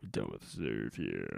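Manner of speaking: lowest bass voice with fry; dropping voice deep. Shown as a demonstration. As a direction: rumble it out as low as possible. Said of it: "And here is Austin".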